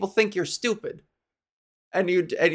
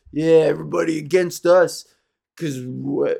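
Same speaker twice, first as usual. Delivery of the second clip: mock smug bro; 3 semitones lower than usual. Mocking Toronto shirt wearers, a cocky bro. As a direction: play a cocky jerk bragging.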